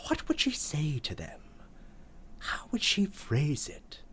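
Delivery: hushed musing whisper; whispered speculation. Acted. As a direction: wonder aloud under your breath.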